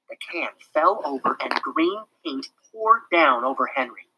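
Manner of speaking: ordinary delivery, nothing marked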